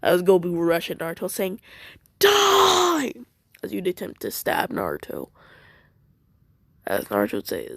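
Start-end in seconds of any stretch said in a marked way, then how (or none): 2.2-3.1 very high, loud attack scream; battle cry. Acted. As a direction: scream it while charging. Gobu rushes at Naruto.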